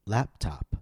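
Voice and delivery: "Laptop" with LAP stressed, top falling; stress on first noun. Shown as a demonstration. Teacher leading listen and repeat, "Laptop" stressed on LAP. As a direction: hit "Lap", drop "top". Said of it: "paying careful attention to syllable stress".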